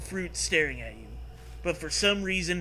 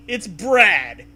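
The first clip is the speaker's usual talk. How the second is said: emphatic; 6 semitones higher, 12 dB louder than usual. A narrator's reading. Introducing Brad.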